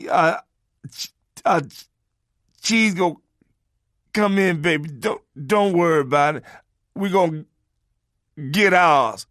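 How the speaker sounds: halting mock stammer to baby; imitated stutter. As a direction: stammer while reassuring his woman. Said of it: "A person got a fourth grade education and you stutter".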